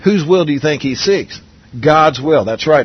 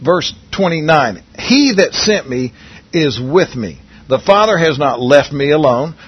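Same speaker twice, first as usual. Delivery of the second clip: ordinary talk, nothing marked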